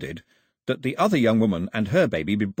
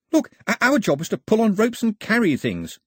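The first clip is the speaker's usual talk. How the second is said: higher, louder aggrieved protest; complaining; 13 semitones higher, 4 dB louder than usual. Acted. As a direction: grumble that this isn't his job. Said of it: "Pilu complained".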